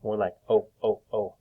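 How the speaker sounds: O held long as oh; long o sound. Shown as a demonstration. Teacher modelling the long o for the class, long oh. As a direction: draw out each O. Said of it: "There's also a long O sound".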